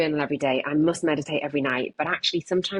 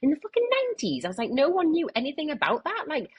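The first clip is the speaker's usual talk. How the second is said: high-pitched protest; 11 semitones higher than usual. Retold or imitated. Reassuring upset mum, retold.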